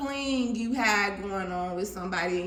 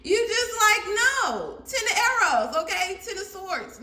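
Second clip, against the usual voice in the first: high, loud mock dismissal of the card; high exclaim; 8 semitones higher, 3 dB louder than usual. Scene: reader mocking the client's shocked refusal, high and loud. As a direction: throw the card back, laughing at it.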